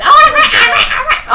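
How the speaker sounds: high, loud, laughing "I'm right"; laughing mock gloat. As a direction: gloat through a laugh, mocking the teacher. Mocking the teacher's insistence.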